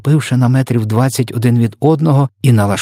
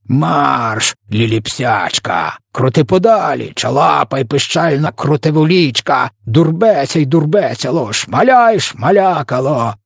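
jeering shouts; 6 semitones higher, slower than usual. A narrator's reading. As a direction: drive him off with drawn-out taunts. Giants jeering after fleeing victim.

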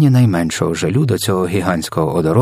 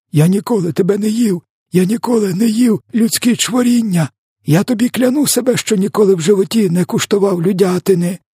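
very high, wailing protest; croaking plea; 12 semitones higher than usual. A narrator's reading. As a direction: swear innocence in a desperate high wail. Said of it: "хрипів він". (відчайдушні високі запевнення)

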